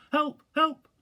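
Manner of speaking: tiny voice calling out from under Pooh; squeaky voice. Acted. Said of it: "My voice has gone all squeaky".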